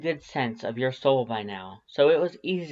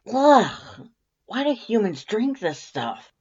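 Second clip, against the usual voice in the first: loud, high disgusted groan; 10 semitones higher, 6 dB louder, slower than usual. A narrator's reading. Undyne tasting coffee for the first time, loud disgust. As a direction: recoil from the bitter taste.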